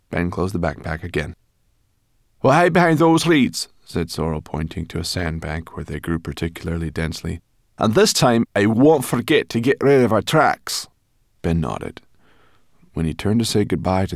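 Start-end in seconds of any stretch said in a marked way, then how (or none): 2.4-3.7 very high, loud and bossy; a feisty brownie giving orders; Sorrel. A narrator's reading. Sorrel tells them to hide, high and loud. 7.8-10.9 very high, loud and bossy; a feisty brownie giving orders; Sorrel. A narrator's reading. Sorrel tells them to hide, high and loud.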